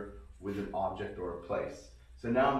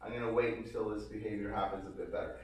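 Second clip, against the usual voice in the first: normal talking voice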